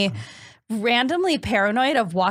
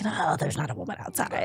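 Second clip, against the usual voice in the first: sleepy parents; 5 semitones lower, 7 dB quieter than usual. Retold or imitated. Parents dismissing child at night.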